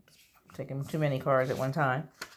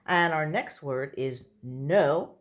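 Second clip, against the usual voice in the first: ordinary delivery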